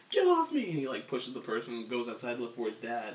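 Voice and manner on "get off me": high, loud, desperate yell; shoving someone off. Retold or imitated. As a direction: push them away and shout. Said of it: "He's like".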